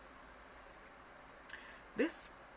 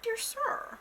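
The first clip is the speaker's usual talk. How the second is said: very high opening, plaintive; mock plea to the departing dad; mock pleading child; 6 semitones higher than usual. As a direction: plead like a wounded kid. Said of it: "I'm like".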